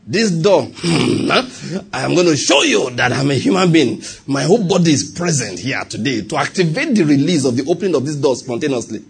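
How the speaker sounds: mock praying. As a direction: pray at it with mock solemnity. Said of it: "start praying".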